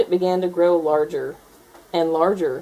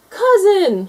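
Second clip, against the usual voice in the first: high loud happy shout; joyful shout; 14 semitones higher, 9 dB louder than usual. A narrator's reading. Gator spots dinosaur balloon cousin, high joyful shout. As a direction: call out across the room. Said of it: "she shouted".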